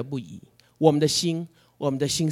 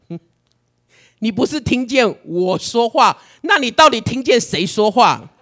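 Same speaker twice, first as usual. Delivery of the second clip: God's playful rebuke; 5 semitones higher, 6 dB louder than usual. Retold or imitated. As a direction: tease back with a knowing question. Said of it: "神就说". (神慢慢地调侃反问)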